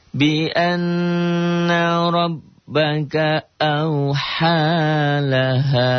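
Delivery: slow, high chanted tilawah; Quran recitation. Shown as a demonstration. Teacher chanting the verse for students, slow and melodic. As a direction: draw each vowel out in melodic recitation.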